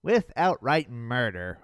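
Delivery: ordinary delivery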